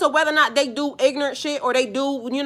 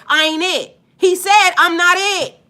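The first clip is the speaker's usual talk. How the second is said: much higher, louder insistent quote; emphatic quote; 7 semitones higher, 8 dB louder than usual. Retold or imitated. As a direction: shout his humility back at them.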